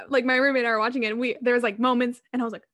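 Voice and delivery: normal talking voice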